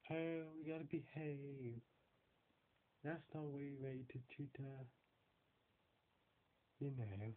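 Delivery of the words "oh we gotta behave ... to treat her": posh British impression. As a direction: send up a prim British gent, slowly. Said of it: "British posh people who go like".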